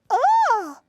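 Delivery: high, loud "ah"; imitated exclamation. Co-host quoting Miss Ball's performance, high imitated "ah". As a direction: mimic her with a high, loud cry. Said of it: "She said".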